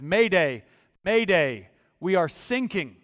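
lightly acted Mayday call, loud on each Mayday; English radio distress call. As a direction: call out a mock distress message over the radio. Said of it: "this English voice comes over the radio".

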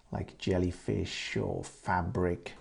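usual speaking voice

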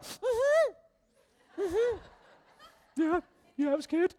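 high, tight voice fighting back tears; choking up. Retold or imitated. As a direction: answer while about to cry. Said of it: "And started crying".

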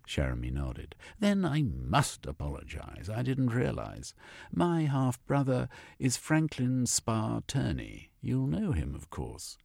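a supercilious man turning suddenly polite; hint of a lofty gentleman scientist; haughty zoologist.

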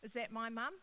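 usual speaking voice

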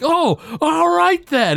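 high, drawn-out cartoon voice; mock dawning realization. Homer finally takes the hint, hammed-up realization. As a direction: stretch it out high as if finally getting it.

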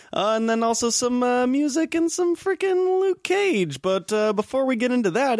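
overly cheery show-host voice; weird robot hype voice. Acted. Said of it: "This is like a weird robot, [name]".

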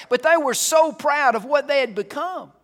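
very high, very loud pulpit climax; shouted at the top of the preacher's range; impassioned preaching shout. Real, not acted. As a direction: cry it out at full force. Sermon climax, then hushed repeat.

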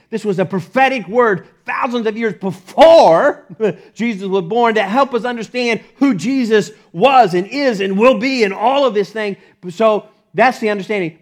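loud, driving sermon delivery; energetic pulpit proclamation; fervent preaching. Acted. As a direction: press the point home to the church.

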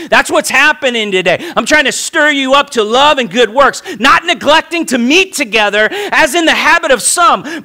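high, loud sermon cry, close to shouting; preaching at full force. Acted. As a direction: rouse the congregation, building to a peak.

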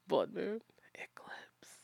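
slow breathy whisper, doom-laden; gloomy goth deadpan. Shown as a demonstration. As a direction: whisper it like an ominous omen. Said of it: "You have to say it like that".

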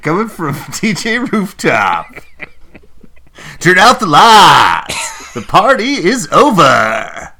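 slow, loud, high DJ sign-off; morning zoo DJ. Pretend. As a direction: boom out the closing like a radio host. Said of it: "Sound like morning zoo".

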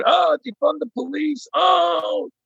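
hammed-up protester yell, pitch way up; mock screaming protest. Activists screaming to defund the police, mock scream. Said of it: "people that are screaming".

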